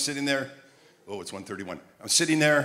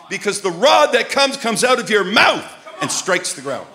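loud, high, slow preacher's shout; full-force preaching; 9 semitones higher, 11 dB louder, slower than usual. Real, not acted. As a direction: stretch key words and belt them out.

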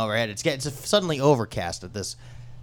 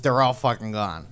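ordinary talk, nothing marked

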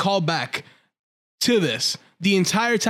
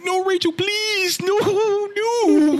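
much higher, slow, hammed-up begging; mock crying plea; 14 semitones higher, much slower than usual. Clingy guy begging Rachel not to go, mock crying. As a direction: wail it like a clingy crybaby, mock tearful. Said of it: "He started crying".

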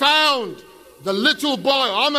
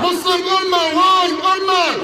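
high, shouted chant leading a crowd; loud slogan; 6 semitones higher, 4 dB louder than usual. Real, not acted. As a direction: belt out the rallying slogan. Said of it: "Let's say it loud".

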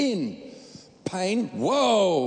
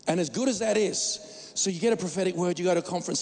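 ordinary talk

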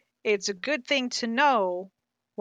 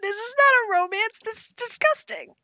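much higher, rising in disgust; outraged; 13 semitones higher, 4 dB louder than usual. Real, not acted. Disgust at a book sold as romance, much higher outraged rise. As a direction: cry out that it's disgusting.

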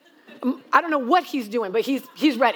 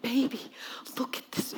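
whispered awe; awed whisper; 10 dB quieter than usual. Retold or imitated. Mom showing daughter the waterfall, awed whisper. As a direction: breathe it out in wonder.

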